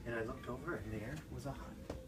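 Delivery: plain reading voice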